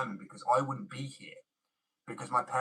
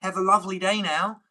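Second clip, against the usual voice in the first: higher, louder mock gushing thanks; sarcastic sweet thanks; 8 semitones higher, 10 dB louder than usual. Mocking a rude critic, sarcastic gushing thanks. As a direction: overdo the syrupy politeness. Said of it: "You think I'd go".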